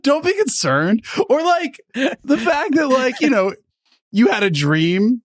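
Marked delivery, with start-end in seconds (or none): 0.0-3.6 much higher, sing-song sarcastic calm; sarcastic falsetto reassurance; mock reassurance. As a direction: pretend nothing is wrong, high and airy.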